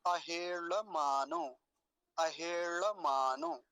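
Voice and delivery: अहेळमानो spoken very slowly, ळ retroflex; slow word demo. Shown as a demonstration. Teacher slowly models the full word for students. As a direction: stretch अहेळमानो out syllable by syllable. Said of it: "देखिए".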